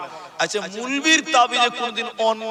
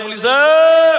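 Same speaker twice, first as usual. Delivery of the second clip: high, loud call; 6 semitones higher, 10 dB louder, much slower than usual. Retold or imitated. Boy arriving at evening calling the maulana, high loud call. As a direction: call him from the door.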